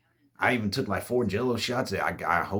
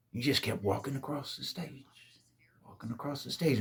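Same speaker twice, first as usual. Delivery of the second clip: creepy whisper; 3 semitones higher, 8 dB quieter than usual. A narrator's reading. Eerie description of masked figure.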